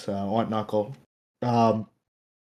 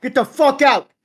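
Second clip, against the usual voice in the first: second take much higher and louder; mock yell; 11 semitones higher, 4 dB louder than usual. Guest repeats the line as a high mock yell. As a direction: escalate into a hammed-up shout. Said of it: "I'm like".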